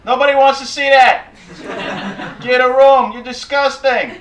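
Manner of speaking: loud, high outburst of disgust; big mock-outraged New Yorker shout; indignant New Yorker. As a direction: yell it at a kissing couple like an outraged New Yorker. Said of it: "their response is".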